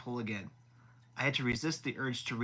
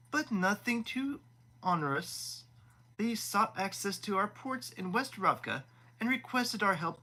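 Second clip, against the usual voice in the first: higher, smug and self-satisfied prince; 7 semitones higher than usual. Acted. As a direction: preen while laying out the terms. Vasily explaining the deal.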